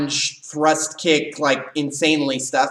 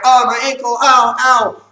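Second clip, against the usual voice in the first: high, loud mock whimper of pain; playing the injured student, hammed up; mock injured kid; 7 semitones higher, 8 dB louder than usual. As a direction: ham up a kid hurting his ankle. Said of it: "and like".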